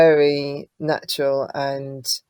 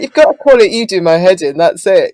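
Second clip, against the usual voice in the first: imitating a Leeds accent, raised and loud; 5 semitones higher, 12 dB louder than usual. Co-writer telling her what to call it, Leeds accent. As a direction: mimic an emphatic Leeds accent. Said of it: "with his lead accent".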